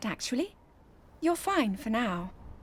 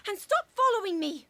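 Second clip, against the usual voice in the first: angry shout; 6 semitones higher, 5 dB louder than usual. Acted. Princess shouts at trailing knight.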